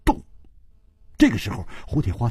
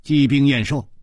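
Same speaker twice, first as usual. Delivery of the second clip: ordinary delivery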